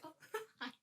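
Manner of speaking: high, soft, awkward little hi with a laugh. Retold or imitated. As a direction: shrink and squeak out an awkward hi. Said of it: "Me just being awkward".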